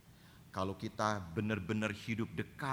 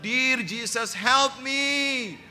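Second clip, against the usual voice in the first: high, loud pleading prayer; mock desperate prayer; 10 dB louder, much slower than usual. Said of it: "Saya bilang". (doa memelas, tinggi dan keras)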